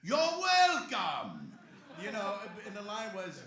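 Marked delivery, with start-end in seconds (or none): 0.0-1.5 booming, long-drawn, high character shout; Hondo. Acted. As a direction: shout it slowly, stretching every word. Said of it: "with Hondo".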